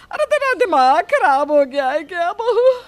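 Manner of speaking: loud, high, outraged scold with a cry in it; angry scolding. Acted. Mother-in-law scolding daughter-in-law Pinki, loud and outraged. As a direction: tear into her for the stupid stunt.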